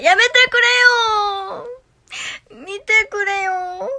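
high, loud, very slow stretched wail; drawn-out whiny plea. Acted. Pleading with viewers in a high, slow mock wail. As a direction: stretch each vowel like a mock whine.

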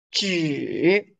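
纸 dipping low in third tone; third tone zhi. Shown as a demonstration. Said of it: "纸 is paper".

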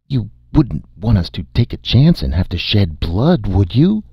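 ordinary delivery

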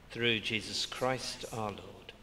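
ordinary talk

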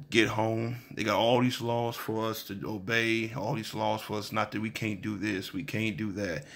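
normal talking voice

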